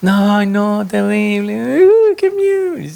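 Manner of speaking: burla de un miedoso, voz muy aguda y lenta; miedo de gallina. Retold or imitated. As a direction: imita a un cobarde asustado, estirando las palabras. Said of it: "¿Usted reacciona tipo gallina?". (creyente ante la prueba, imitado como gallina asustada y chillona)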